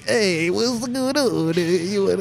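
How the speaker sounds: high, very drawn-out mock Italian greeting; fake Italian accent. Imagined staffer greeting diners, hammed-up fake Italian accent.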